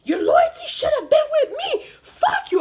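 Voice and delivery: high-pitched, loud angry yell; screaming. Retold or imitated. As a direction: scream it out, voice shooting way up. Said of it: "And I'm screaming".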